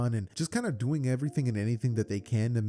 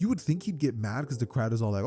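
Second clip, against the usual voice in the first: ordinary delivery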